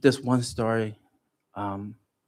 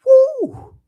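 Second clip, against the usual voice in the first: high emotional whoop; 12 dB louder than usual. Retold or imitated. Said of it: "I just started crying".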